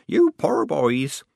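put-on slow, pitying old woman; slow and sorry for them; old lady. A narrator's reading.

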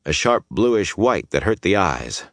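usual speaking voice

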